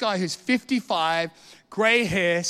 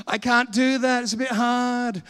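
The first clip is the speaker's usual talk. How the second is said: slow, drawn-out mock complaint; whining excuse; 5 semitones higher than usual. Preacher mimicking Christians who find it hard, whiny. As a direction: mimic a feeble, reluctant believer. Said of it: "you get Christians who go".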